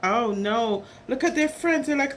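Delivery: ordinary delivery, nothing marked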